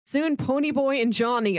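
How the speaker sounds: usual speaking voice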